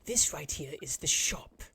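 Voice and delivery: slow whisper; whispered guide. Acted. Frog guide showing the shop, whispering slowly. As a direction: breathe the words like a secret. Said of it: "He goes".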